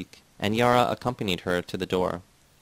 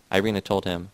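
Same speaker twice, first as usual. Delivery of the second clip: ordinary delivery, nothing marked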